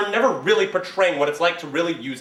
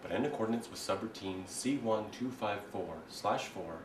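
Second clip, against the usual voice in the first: HAL computer voice; 10 semitones lower, 15 dB quieter than usual. Acted. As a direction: speak like a cold ship computer.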